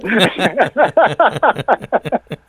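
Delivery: long run of high-pitched laughter; hearty laugh. Real, not acted. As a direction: laugh on and on at the joke. Carcajada tras el chiste. (carcajada prolongada y aguda)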